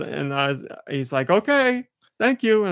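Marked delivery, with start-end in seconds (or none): 1.3-2.6 high-pitched, placating okay; lilting, pitched-up mock courtesy; imitated polite reply. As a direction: mimic an awkward delivery guy signing off. Acting delivery guy's awkward reply.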